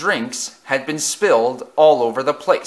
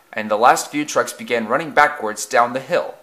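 ordinary delivery

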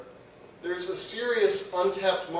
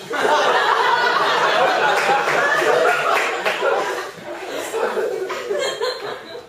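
laughter; 12 semitones higher, 6 dB louder than usual. Real, not acted. Audience laughter opening bit.

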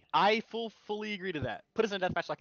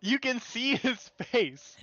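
teasing; 9 semitones higher, 4 dB louder, much slower than usual. Acted. Teasing about face reveal.

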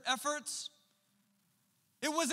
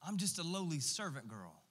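humble Mary; 8 semitones lower, 7 dB quieter than usual. Retold or imitated. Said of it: "she says".

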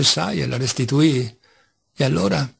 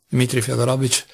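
usual speaking voice

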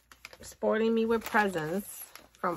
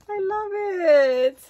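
teary love; 3 semitones higher, 6 dB louder than usual. Real, not acted. Moved by her bestie's gift, loud teary joy. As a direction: say it almost crying with joy. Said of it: "you're gonna make me cry".

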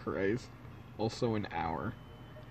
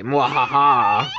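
slow hammy villain laugh; evil laugh; 6 dB louder, slower than usual. Acted. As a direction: cackle like a movie villain.

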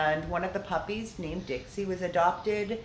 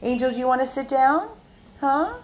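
higher, louder pet-talk voice; coaxing the dog; 6 semitones higher, 7 dB louder than usual. Real, not acted. Owner asking Angel to sit down, coaxing. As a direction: coax a dog with a raised, quicker question.

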